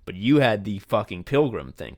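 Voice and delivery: usual speaking voice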